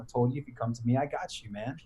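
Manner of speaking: soft, calm reassurance; Jesus reassuring Peter, quiet and calm. Retold or imitated. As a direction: gently promise him he's safe.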